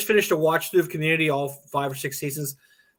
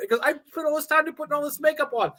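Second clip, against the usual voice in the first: mock whiny protest; 10 semitones higher than usual. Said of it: "I'm like".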